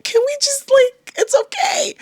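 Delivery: high, slow, crying plea; pleading cry. Retold or imitated. Pleading with God to let her settle. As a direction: beg like you're about to cry. Pleading end of inner monologue.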